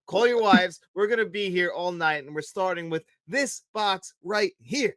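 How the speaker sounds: hammed-up announcement to the staff; mock-official orders. Quoting the bluffing official's orders.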